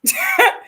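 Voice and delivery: a sudden high burst of laughter; a shrieking laugh at her own story. Real, not acted.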